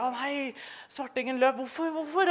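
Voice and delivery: a hint of a panicky teacher; flustered teacher voice. The teacher reacts as the boy runs out, high and flustered.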